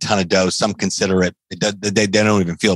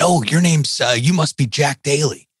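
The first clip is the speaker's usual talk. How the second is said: excited; 8 semitones higher than usual. Retold or imitated. Meeting a speaker, starstruck.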